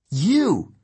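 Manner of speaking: high, startled accusation; accusing exclamation. Acted. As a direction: throw it at the traitor. Annabeth confronts the spy.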